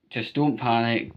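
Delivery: ordinary talk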